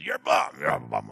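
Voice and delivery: rough, slurred growl from half the mouth; garbled manager Mick. Retold or imitated. Preacher imitating the manager berating his boxer, garbled growl. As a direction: growl the insult, then dissolve into garble. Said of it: "talks only out of half of his mouth".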